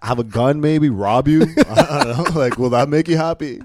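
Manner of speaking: high, loud mock eagerness to please; comic exasperated sarcasm; sarcastic offer. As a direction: pile up absurd offers, half laughing. Sarcastic offer to fit stereotype.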